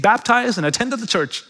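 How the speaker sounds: ordinary talk, nothing marked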